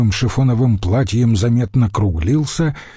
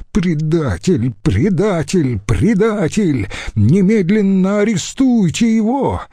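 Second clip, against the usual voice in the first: outraged yelling; 8 semitones higher than usual. A narrator's reading. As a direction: escalate into a high, urgent demand. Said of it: "заорал он". (возмущённые выкрики)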